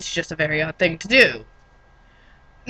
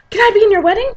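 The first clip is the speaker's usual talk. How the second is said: gasp, then a much higher, louder eager plea; 13 semitones higher, 5 dB louder, faster than usual. Retold or imitated. To a coworker, begging to be in her wedding, gasping eagerly. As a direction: beg to join her wedding, overexcited. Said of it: "I looked at her and went".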